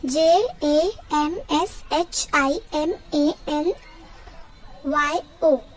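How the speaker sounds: slow spelling with a lift on each letter; spelled out. Shown as a demonstration. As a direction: spell it out slowly, one letter at a time. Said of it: "teach you how to pronounce it".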